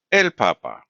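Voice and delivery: "papa" stressed on the first pa; isolated demo of "el papa", last syllable light. Shown as a demonstration. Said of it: "the syllable right before the last one".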